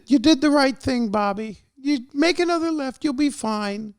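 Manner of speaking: very high-pitched doting mom voice; squeaky falsetto mother reassuring her child. Acted. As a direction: coddle your grown son in falsetto.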